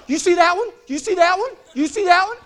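imitating a frantic wife jabbing at each snake; panicky wife pointing. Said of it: "She's like".

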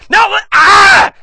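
high, loud, drawn-out scream cut off; panicked yell. Real, not acted. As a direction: break off into a real yelp. Fleeing the mosquito swarm.

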